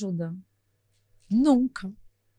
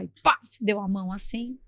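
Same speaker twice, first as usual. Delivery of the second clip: ordinary delivery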